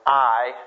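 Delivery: "I" said as one syllable, like "eye"; seminary pronunciation. Shown as a demonstration. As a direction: give "I" as a single long-i vowel. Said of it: "I was taught to pronounce".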